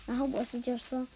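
usual speaking voice

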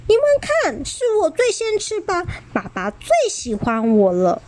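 put-on high show-off child voice; a bit smug. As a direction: show off to the siblings. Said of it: "有点得意地说".

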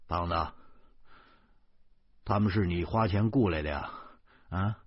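words round crammed-in sausage; mouth full of sausage. Acted. Narrator stuffing sausages in, mumbling a question to Zhou Pangzi. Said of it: "窝窝囊囊的说".

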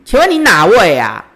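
a loud, curt demand asking who it is; loud and rude. Retold or imitated. Retelling a loud rude reply to the unknown caller. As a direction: snap back at the caller, unfriendly and loud. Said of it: "很大声、很不客气的回答他".

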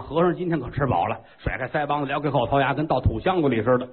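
usual speaking voice

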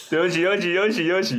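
giggling sorry, sorry; laughing apology. Real, not acted. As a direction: apologize while you can't stop laughing. Apologizes while still laughing.

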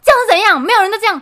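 very high, very loud mock yell; hammed-up furious shout at the customer; imitating a shouting shopkeeper. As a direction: yell it like an old shopkeeper losing his temper. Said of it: "他就是这么凶的吼".

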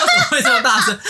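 very high, laughing disbelief; shocked. Retold or imitated. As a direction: squeal it in amazed horror. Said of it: "我在那边吓到".

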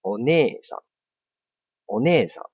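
お姉さん with ね stretched; Japanese long vowel. Shown as a demonstration. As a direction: hold ね in お姉さん. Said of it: "譬如我们说".

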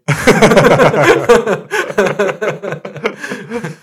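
long, loud high laughing fit; big laugh. Real, not acted. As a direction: crack up loudly at the joke. Hosts crack up over reply. (放声大笑)

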